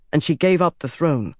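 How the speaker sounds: usual speaking voice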